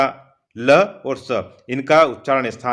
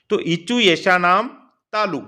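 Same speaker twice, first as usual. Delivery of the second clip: usual speaking voice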